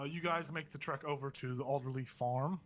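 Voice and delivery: ordinary delivery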